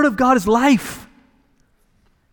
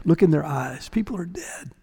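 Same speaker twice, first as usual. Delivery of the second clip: hushed whisper; 4 semitones lower, 7 dB quieter than usual. Acted. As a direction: whisper it, much softer. Preacher drops to a hush.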